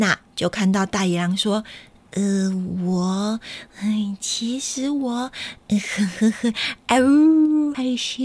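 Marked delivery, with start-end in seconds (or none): 2.1-8.2 shy wolf. Acted. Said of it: "大野狼怎么突然害羞了啊".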